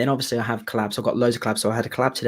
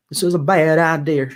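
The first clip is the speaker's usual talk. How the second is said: Nashville Southern twang on the line; sing-speak it like a Nashville country singer; Southern accent; 3 semitones higher, 5 dB louder than usual. Shown as a demonstration. Singer demonstrating the Southern accent of the duet line. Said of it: "it's in a Southern accent".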